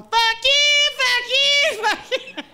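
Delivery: high, loud triple curse; quitting shout. Retold or imitated. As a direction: yell it on the way out. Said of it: "I quit that job going".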